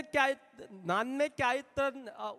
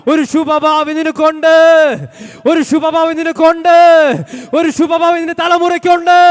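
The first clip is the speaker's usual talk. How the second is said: a preacher lifting the congregation higher and louder; fervent preaching; 5 semitones higher, 11 dB louder than usual. Real, not acted. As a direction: proclaim the promise, each line climbing. Preaching at full force.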